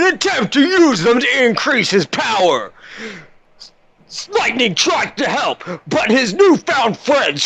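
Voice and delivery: high, hyped promo voice; trailer announcer. Acted. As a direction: sell it like a movie trailer. Reads the episode promo.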